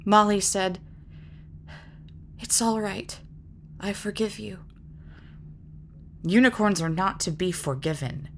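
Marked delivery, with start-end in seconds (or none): 2.4-4.6 much softer, fading to almost nothing; soft forgiveness. Acted. Molly softly forgives the unicorn, touching its cheek. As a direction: let it go gently, barely aloud.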